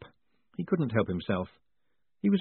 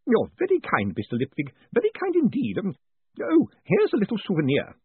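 high, bubbly, eager craftsman; a kindly engraver tripping over his thanks; pleased old tradesman gushing over a visitor; eager Mister Spools; 9 semitones higher than usual. A narrator's reading.

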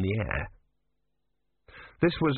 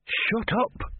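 hissed; 12 semitones higher than usual. Acted. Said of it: "hissed Brother".

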